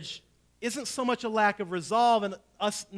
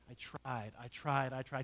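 tired; 7 semitones lower, 11 dB quieter than usual. Real, not acted. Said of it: "repeated resigned admission".

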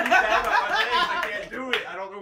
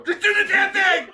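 impatient laughing shout; 11 semitones higher, 7 dB louder than usual. Real, not acted. Impatient before shock.

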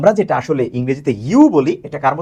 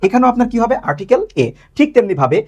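ordinary talk, nothing marked

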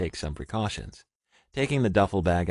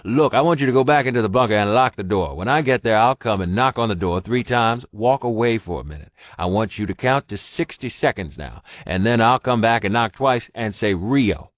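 louder, firm commanding orders; gruff and commanding; 4 semitones higher, 8 dB louder than usual. A narrator's reading. Ross ordering Richard into the bunker, commanding. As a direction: give orders like a sergeant. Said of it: "his voice turning gruff and commanding".

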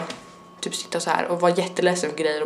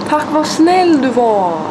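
gushing thanks; 6 semitones higher, 6 dB louder, much slower than usual. Real, not acted. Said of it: "Hur ska jag göra?".

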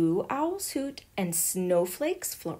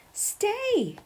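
calling out; 11 semitones higher than usual. A narrator's reading. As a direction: call her back. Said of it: "called out".

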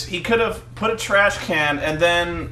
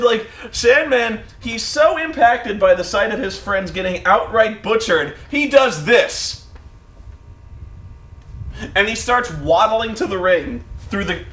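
ordinary talk

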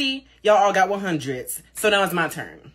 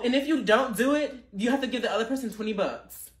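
ordinary talk, nothing marked

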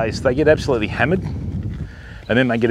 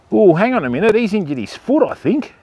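normal talking voice

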